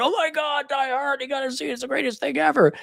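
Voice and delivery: very high-pitched fan gushing; enthusiastic raving. Acted. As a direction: hype it up like an ecstatic movie fan. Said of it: "There's no excitement or enthusiasm".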